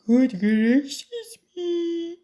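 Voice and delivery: high, loud, drawn-out dismay; slow, hammed-up astonishment; shocked exclamation. A narrator's reading. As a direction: cry out like an aghast mother. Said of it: "and exclaimed".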